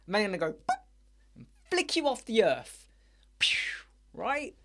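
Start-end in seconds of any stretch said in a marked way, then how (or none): 0.5-0.8 very high squeaky boop noise; squeaky boop as the dealer flicks you away. Retold or imitated. 3.4-3.9 whispered pew. Retold or imitated. Imitated zap noise.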